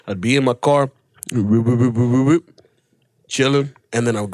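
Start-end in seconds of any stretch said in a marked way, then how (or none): 1.3-2.6 quick, repeated whoop sound effect; imitated whooping noise. Comic telling of drinking in car, imitated whoops. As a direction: rattle off a string of whoops.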